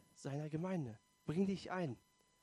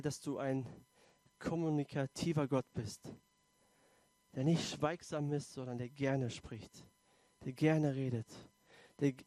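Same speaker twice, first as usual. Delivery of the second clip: prayer. Acted. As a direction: pray gently and sincerely. Leads congregation in prayer.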